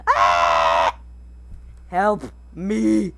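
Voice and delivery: low shouted cry for help; tummy crying for help. Pretend. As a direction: voice the growling stomach. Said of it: "It must be saying".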